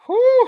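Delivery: loud, high exhaled whew; relieved whew. Real, not acted. Commentator recovering after a brutal table smash, loud whew. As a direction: blow out a big whew.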